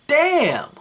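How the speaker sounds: very high, loud exclamation in a changed voice; shocked exclamation. Retold or imitated. Dreamer watching Kate about to kiss, shocked high outcry. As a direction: let it burst out in dismay.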